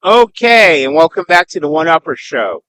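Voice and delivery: loud, upbeat host welcome; game-show host greeting the audience; show host intro. Acted.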